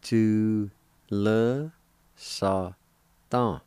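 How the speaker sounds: drawn-out, separated syllables. Shown as a demonstration. Teacher showing the little addition to jar, syllable by syllable. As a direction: give each syllable its own beat. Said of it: "would be pronounced like this".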